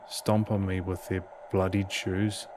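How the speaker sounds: normal talking voice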